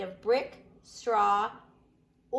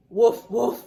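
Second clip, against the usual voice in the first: loud playful mock bark; big teasing "Woof, woof"; dog bark imitation; 13 dB louder than usual. Shown as a demonstration. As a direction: bark it like a silly dog for the kids. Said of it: "Does a pig say".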